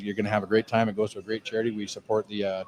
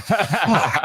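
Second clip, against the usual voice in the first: laughing curse; 8 semitones higher, 4 dB louder than usual. Real, not acted. Caught out by wife's offer.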